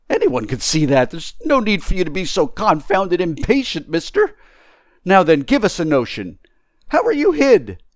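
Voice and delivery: high, loud, complaining tramp; indignant tramp demanding an answer; exasperated tramp. Acted. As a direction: argue back, pitch climbing at the end.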